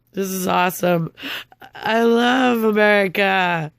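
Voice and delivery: slow, drawn-out mock praise; bitter sarcasm. Real, not acted. Wronged woman praising her country, bitterly sarcastic. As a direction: stretch it out with heavy irony.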